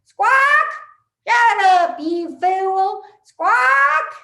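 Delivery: shrill squawking parrot, loud and high; loud, high parrot impression. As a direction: screech it like a cranky parrot. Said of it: "that parrot would say".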